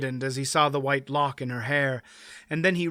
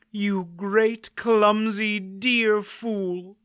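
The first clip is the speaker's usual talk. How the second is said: tender scolding; 6 semitones higher, much slower than usual. Acted. Pol embraces Dernick.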